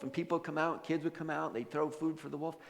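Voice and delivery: ordinary delivery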